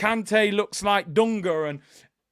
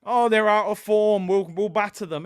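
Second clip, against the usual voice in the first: mock cocky fan; 3 semitones higher, 7 dB louder than usual. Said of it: "We know what time it is".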